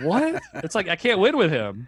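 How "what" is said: mock disbelief, much higher; incredulous what to a ridiculous tip; baffled what. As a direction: squeak out a stunned what. Retold baffled reply to driver.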